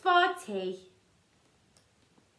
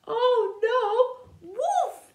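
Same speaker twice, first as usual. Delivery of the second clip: alarmed; 7 semitones higher, 4 dB louder than usual. Acted. Alarm at the wolf.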